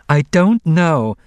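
high, loud, slow protest; insistent denial. A narrator's reading. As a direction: stretch out an exasperated denial under pressure. Repeated denial when pressed.

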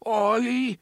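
high, loud, trembling animal cry; trembling creature call. A narrator's reading. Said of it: "the tremble in his hoarse voice".

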